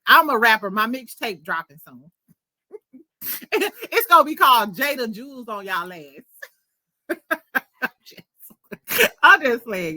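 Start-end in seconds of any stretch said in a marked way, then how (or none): 3.2-10.0 joking through real laughter; words breaking into giggles; laughing. Real, not acted. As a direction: crack up at your own boast. Joking about her own mixtape, laughing.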